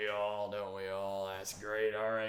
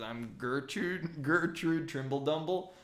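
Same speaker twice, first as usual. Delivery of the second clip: shy gnome; 4 semitones higher than usual. Acted. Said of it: "She's kinda shy".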